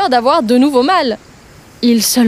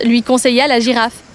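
usual speaking voice

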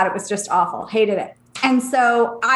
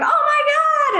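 much higher, thrilled gasp; retold excitement; slower than usual. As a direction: squeal it, delighted. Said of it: "And I was excited".

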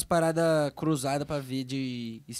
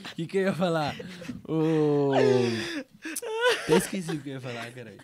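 talking through laughter; giggling while trying to speak; laughing. Real, not acted. Friends cracking up mid-question. (rindo enquanto fala)

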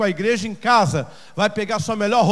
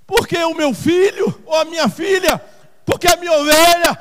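high, loud cry to heaven; impassioned, raised-voice plea; anguished pleading; 7 semitones higher, 8 dB louder than usual. Acted. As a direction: cry out the questions to God. Acted anguished question to God.

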